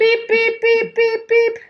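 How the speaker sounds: high, loud electronic beeps; imitated beeping. Mimicking the app at the dinner table, high beeps. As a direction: beep like a shrill app alarm.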